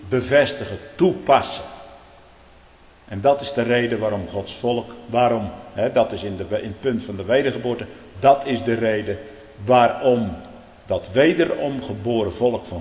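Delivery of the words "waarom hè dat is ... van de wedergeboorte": normal talking voice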